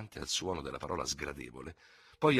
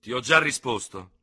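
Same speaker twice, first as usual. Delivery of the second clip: loud, controlled reply; restrained but forceful; firm self-control; 6 semitones higher, 10 dB louder than usual. A narrator's reading. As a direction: answer firmly, mastering yourself. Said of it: "disse l'altro dominandosi benissimo".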